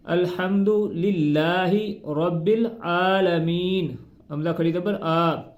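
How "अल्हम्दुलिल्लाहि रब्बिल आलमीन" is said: slow careful अल्हम्दुलिल्लाहि रब्बिल आलमीन with long आ; slow correct reading. Shown as a demonstration. Teacher showing correct Fatiha reading. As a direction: read slowly with proper long vowels. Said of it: "आलामीन".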